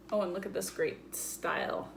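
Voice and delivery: ordinary talk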